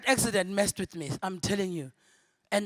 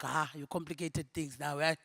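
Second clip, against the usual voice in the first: teasing aside; 7 semitones lower, 9 dB quieter than usual. Retold or imitated. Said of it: "I was like".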